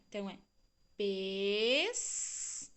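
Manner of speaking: ordinary talk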